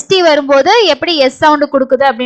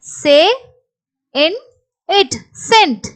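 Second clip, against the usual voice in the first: sounding out; 3 semitones higher, much slower than usual. Shown as a demonstration. Teacher showing how the word is read, sounded out. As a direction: separate sounds with long pauses. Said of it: "இதை எப்படி படிப்போம்?".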